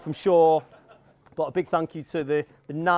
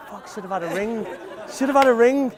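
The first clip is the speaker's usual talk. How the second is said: rising, louder second "should have had a ring"; imagined panicked thought; 7 semitones higher, 3 dB louder than usual. Retold or imitated. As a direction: voice her inner panic, half laughing. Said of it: "it's like".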